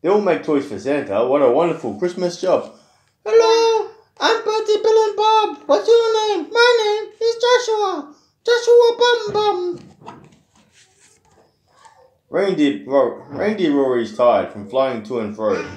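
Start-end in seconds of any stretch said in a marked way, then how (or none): 3.2-9.8 very high, squeaky elf voice. Acted. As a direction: chirp like tiny elves. Elves voiced reading to child.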